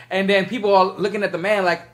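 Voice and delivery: normal talking voice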